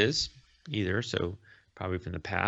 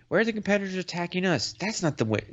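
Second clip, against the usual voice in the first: acted protest; 9 semitones higher, faster than usual. Eden protests at being attacked.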